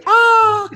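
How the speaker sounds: very high, loud imitated hawk screech; hawk scream. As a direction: screech like a bird of prey. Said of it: "As this hawk would scream".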